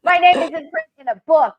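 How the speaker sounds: high, loud burst of joy; thrilled. Real, not acted. Seeing her name in the book, thrilled and loud. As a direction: announce it in disbelief.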